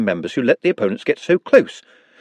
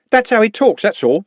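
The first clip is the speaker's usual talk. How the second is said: higher, louder street lad; defensive young man, pitched up; a cocky lad sticking up for a mate; Trev's voice; 10 semitones higher, 4 dB louder than usual. A narrator's reading. Trev defends Nutt.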